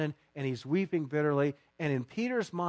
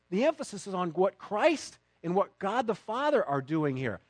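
ordinary delivery

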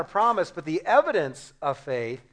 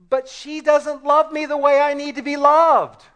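higher, louder petulant whine; whiny complaint; 9 semitones higher, 8 dB louder than usual. Acted. Husband complaining to God about his wife, whiny and high.

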